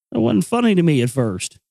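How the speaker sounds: ordinary talk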